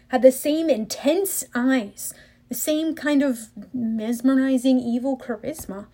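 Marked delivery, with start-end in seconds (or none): none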